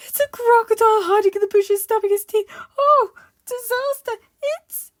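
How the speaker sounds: high, loud, hysterical babbling; panicked shriek. Acted. Mister Jelly imagines crocodiles in the woods, hysterical shrieking. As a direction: shriek in runaway terror, breaking off abruptly. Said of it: "he shrieked".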